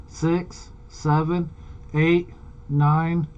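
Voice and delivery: ordinary delivery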